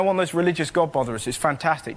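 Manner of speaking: normal talking voice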